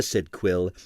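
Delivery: ordinary delivery, nothing marked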